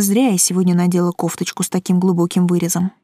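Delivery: plain reading voice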